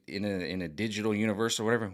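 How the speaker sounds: ordinary talk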